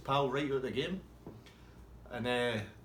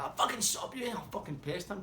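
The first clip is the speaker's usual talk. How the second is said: annoyed punter; 10 semitones higher than usual. Retold or imitated.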